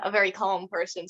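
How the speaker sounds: ordinary delivery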